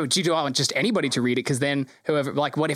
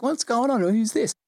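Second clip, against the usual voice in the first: pitched-up confused guest; the GM voicing a confused warlock, pitched up; higher puzzled voice; 8 semitones higher, slower than usual. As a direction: ask it high and baffled. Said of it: "Warlock's at the table like".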